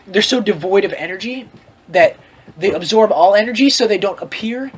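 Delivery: ordinary talk, nothing marked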